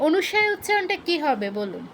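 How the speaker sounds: ordinary talk, nothing marked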